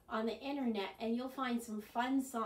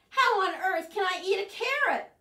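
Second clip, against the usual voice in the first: high, loud exasperated question; outraged at being offered a carrot; indignant shout; 9 semitones higher, 10 dB louder than usual. Acted. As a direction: demand an answer loudly. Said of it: "shouted Bird".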